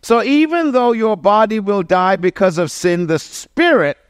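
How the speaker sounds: climbing pulpit fervor; full-force preaching. Acted. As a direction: lift the room, preach it out.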